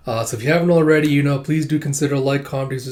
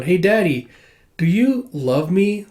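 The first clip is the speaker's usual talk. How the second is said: put-on higher, drawn-out little-girl voice; adorable little child; 6 semitones higher, 3 dB louder, slower than usual. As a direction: ask sweetly, like a child pleading with a father. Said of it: "adorable little baby number fifteen".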